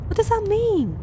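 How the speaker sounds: high-pitched, incredulous question; baffled. Real, not acted. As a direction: blurt it out in real bewilderment. Reader baffled by ping pong line.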